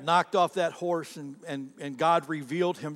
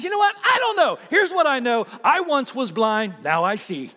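bursts out high and loud, then slows and settles; a blind man triumphantly declaring he can now see; healed man's declaration; 6 semitones higher, 6 dB louder than usual. Retold or imitated. Healed man answers the religious leaders, high and ringing. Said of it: "he finally says".

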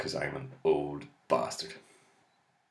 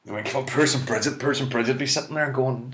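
normal talking voice

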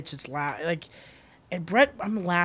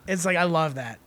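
normal talking voice

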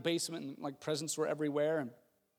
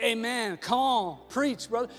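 higher, louder enthusiastic amens; dad cheering a sermon, raised amen; 6 semitones higher, 6 dB louder, slower than usual. Retold or imitated. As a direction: call out amen like a fired-up congregant. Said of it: "he's giving him amen".

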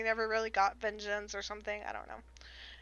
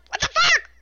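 high, loud disbelieving yelp; shocked outburst; 10 dB louder than usual. Retold or imitated. Listener reacting to the infant's death, shocked outburst. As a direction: blurt it out in appalled disbelief.

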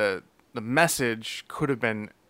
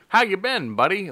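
higher, friendly mock soda jerk; hammed-up soda jerk; 6 semitones higher than usual. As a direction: greet him like an old pal behind the counter. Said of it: "Like, that type of deal".

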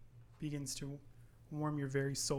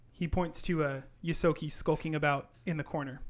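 normal talking voice

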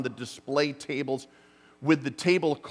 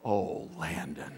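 much lower, slow, exhaled Oh, Landon; slow, very low sighing relief; deep relief; 12 semitones lower, 9 dB quieter, slower than usual. Retold or imitated. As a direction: sink into the relief, drawn out. Repeated relief, deeper.

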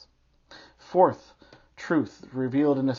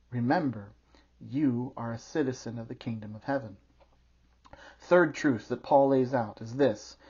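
usual speaking voice

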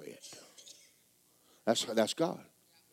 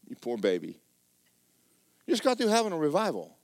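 ordinary delivery

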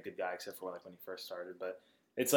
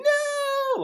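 high shrieked no, hammed up; mock scream; 9 dB louder than usual. Retelling a friend's scream, mock shriek. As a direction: shriek it like a cartoon.

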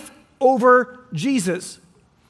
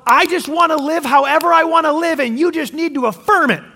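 higher, louder mocking voice of a stubborn demand; mock defiant demand; 5 semitones higher, 4 dB louder than usual. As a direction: ham up a stubborn, self-justifying demand.

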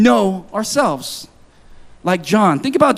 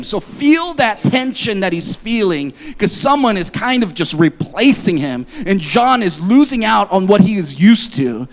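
usual speaking voice

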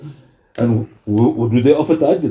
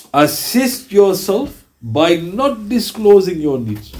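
slow, deliberate hadith quote; solemn recitation; 5 semitones higher, much slower than usual. Acted. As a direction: lay each word down slowly, with reverence. Repeating the hadith.

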